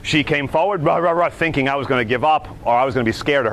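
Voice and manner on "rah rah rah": ordinary talk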